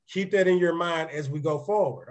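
normal talking voice

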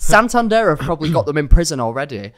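ordinary talk

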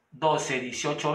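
ordinary delivery

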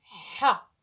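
teacher sounding a letter; short ha with fatha. Shown as a demonstration. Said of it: "أقول لها".

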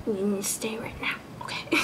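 hushed whisper to unruly bangs; whispered scolding. Real, not acted. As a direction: whisper a stern little order. Muttering at her unruly bangs.

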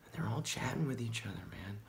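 very soft, secretive murmur; conspiratorial hush. Acted. Stoner revealing that computers chat in code, conspiratorial hush. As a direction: let them in on a dark secret, hushed.

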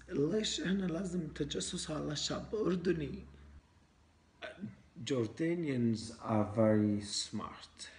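put-on foreigner speaking broken Arabic with an accent; Arabic with a Western foreign accent. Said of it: "إحنا لازم نحكي عربي مكسر".

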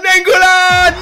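high, loud scream of joy at the pack; ecstatic shout. Real, not acted. Streamer pulling his dream player, screaming with joy. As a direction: yell his name like you just won the lottery.

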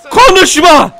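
high, loud order to shut up; shouted command. Pretend. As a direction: silence the enemy with a yell. Silencing game enemy. (öfkeli, yüksek sesle emir)

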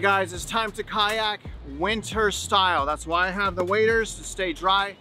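ordinary talk, nothing marked

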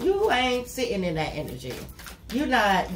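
ordinary talk

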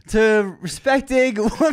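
slow, high mock toast. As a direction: raise a glass, hammed-up. Evan's toast, voiced.

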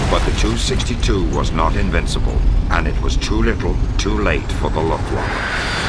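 newsreel narration. A narrator's reading. Narrator telling viewers about the Messerschmitt, rising newsreel style. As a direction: sell the jet like a wartime newsreel. Documentary narration over music.

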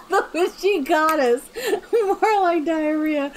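words squeezed out between high giggles; can barely get the joke out for laughing; laughing through it. Real, not acted. Gamer laughing at her own silly caption.

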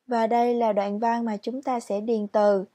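ordinary delivery

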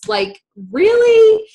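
high, loud, drawn-out disbelief; incredulous. Retold or imitated. Wife protesting the cost to her husband, incredulous. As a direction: stretch it out in mock disbelief.

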